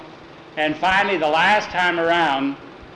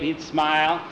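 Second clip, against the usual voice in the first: ordinary delivery, nothing marked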